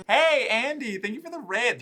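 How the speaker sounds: high, loud hey; excited greeting. Real, not acted. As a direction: call out happily to the raider. Greeting raider on stream.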